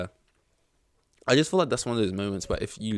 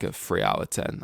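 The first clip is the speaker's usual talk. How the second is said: normal talking voice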